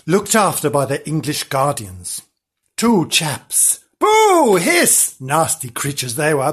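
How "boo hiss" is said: high, loud mock boo and hiss; pantomime booing. Storyteller heckles the wicked guardians, pantomime booing. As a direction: boo the villains like a panto crowd.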